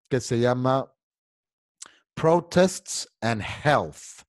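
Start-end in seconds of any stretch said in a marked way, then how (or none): 2.1-4.2 "Protests and Health" said very slowly and clearly; slow, deliberate "Protests and Health" for the class to repeat; slow model reading. Shown as a demonstration. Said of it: "Quiero que todo el mundo me diga".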